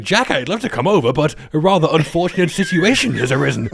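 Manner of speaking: high-pitched imitation of panicked British politeness; frozen with fear. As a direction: play frozen fear under over-polite words. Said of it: "frozen with fear".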